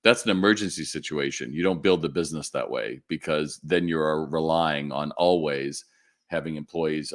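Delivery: ordinary talk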